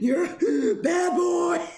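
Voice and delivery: high, slow scolding with a laugh; mock scolding baby talk. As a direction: scold the dog sweetly. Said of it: "everyone's just like".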